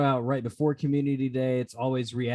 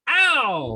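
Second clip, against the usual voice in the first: just got slapped; 9 semitones higher, 4 dB louder than usual. Pretend. Pretend slapped by co-host.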